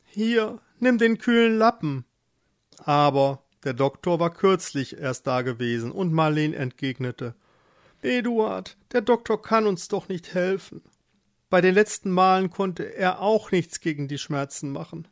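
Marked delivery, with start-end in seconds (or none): 0.1-2.0 high, urgent care; worried husband. Acted. Eduard cools his feverish wife, worried. 8.0-15.1 higher, plaintive wife; suffering wife. Acted. Marleen rejects the doctor, plaintive higher voice.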